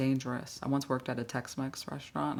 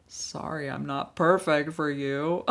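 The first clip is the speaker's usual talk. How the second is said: drawn-out sarcastic sorry, swelling louder; mock apology; 2 semitones higher, 6 dB louder, slower than usual. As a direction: stretch out an offended fake apology. Mock reply to boss's remark.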